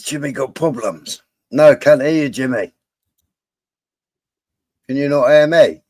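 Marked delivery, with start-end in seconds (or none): 1.5-5.8 loud call into headset; louder, reaching someone who can't hear. Real, not acted. Calling to Jimmy over voice chat.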